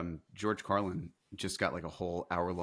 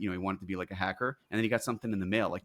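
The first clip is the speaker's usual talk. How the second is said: normal talking voice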